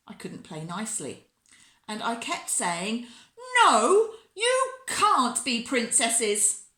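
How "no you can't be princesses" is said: put-on loud, high child's refusal; bossy shout. A narrator's reading. Bella won't play nicely with Sasha, shouting bossily.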